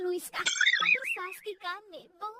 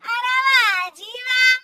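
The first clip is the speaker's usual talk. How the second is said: sarcastic outrage; 10 dB louder than usual. Acted. Mother-in-law mocks daughter-in-law's back-talk, sarcastic.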